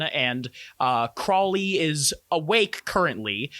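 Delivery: ordinary delivery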